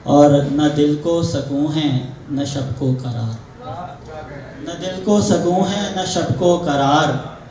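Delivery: measured Urdu mushaira recital with drawn-out line endings; poetic, metered delivery; ghazal recitation. Acted. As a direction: recite the couplet to an audience, phrase by phrase. Poet recites his ghazal at a gathering.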